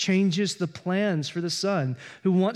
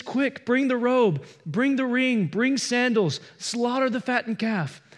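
eager father; 6 semitones higher than usual. Retold or imitated. Father welcoming his lost son home, excited orders. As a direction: hurry the servants with joy. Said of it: "He says".

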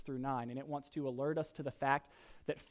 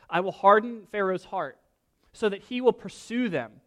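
forceful reading; 4 semitones higher, 6 dB louder than usual. Acted. God's words read with force.

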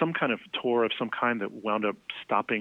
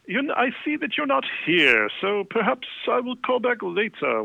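much higher, slow, put-on voice leaving a voicemail; exaggerated high caller voice, barely hiding annoyance; 11 semitones higher, 3 dB louder than usual. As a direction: mimic a rock star's stiffly proper phone message. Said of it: "really annoyed sounding".

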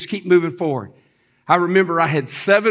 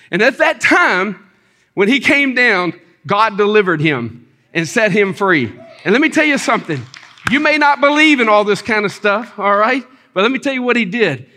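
raised, emphatic pulpit delivery; higher-pitched, punched-out testimony; fervent preaching; 3 semitones higher, 3 dB louder than usual. Real, not acted. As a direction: preach it with rising force.

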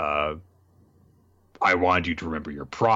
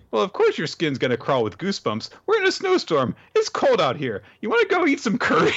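put-on high, bright girl voice; cheerful girl; 11 semitones higher than usual. Girl offering the villain curry in a snowstorm, high and chirpy. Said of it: "Ruru's like".

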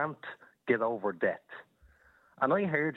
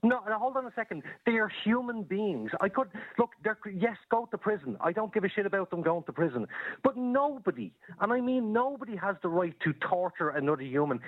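higher-pitched, insistent arguing; heated protest; 5 semitones higher than usual. Real, not acted. Caller defending human beings against torture, heated protest. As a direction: cut in and argue it indignantly.

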